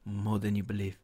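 low, hushed half-whisper; Pep. Retold or imitated. Podcaster imitating a manager's whispered catchphrase, low and soft. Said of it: "Pep's whispered".

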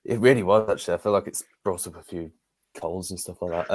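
normal talking voice

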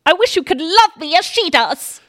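high, loud hammed-up queen; hammed-up Queen Anne. Friend hams up Queen Anne pleading, a high loud plea. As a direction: plead it, overplayed royal. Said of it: "So Anne says".

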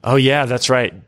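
normal talking voice

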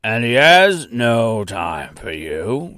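slow, gruff gatekeeper dismissal; taunting doorkeeper growl, drawn out; throaty, gruff. Acted. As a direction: drag it out throatily to shut her out. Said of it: "throaty and gruff".

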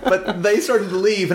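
ordinary delivery

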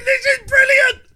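overjoyed cry of triumph; gleeful shout. Retold or imitated. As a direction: shout it with pure delight. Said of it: "and I'm thinking".

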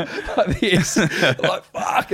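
high laugh through the pain. Retold or imitated. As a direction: groan and laugh together.

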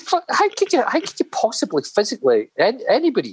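stunned he survived eighteen pints; mock astonishment. Dave to Johnny hearing about eighteen pints, high shocked disbelief. Dave's retold shocked reply.

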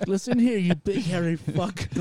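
high, loud, mocking put-on voice; loud boss impression. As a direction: parrot it like a pushy boss.

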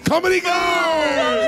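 announcer shout. Acted. Mock announcer cheer.

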